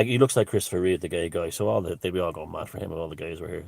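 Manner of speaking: ordinary talk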